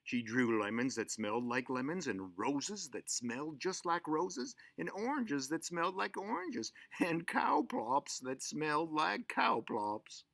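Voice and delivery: plain reading voice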